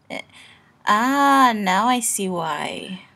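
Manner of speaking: plain reading voice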